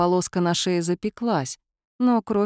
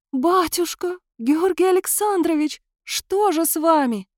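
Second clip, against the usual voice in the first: wailing grief; 10 semitones higher than usual. Acted. Maid wails over the body.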